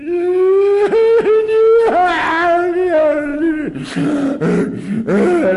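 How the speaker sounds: loud, high wailing sob; mock bawling. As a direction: burst into a hammed-up, drawn-out bawl. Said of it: "I just bawled and bawled".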